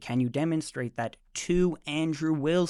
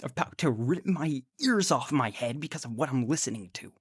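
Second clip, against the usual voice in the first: normal talking voice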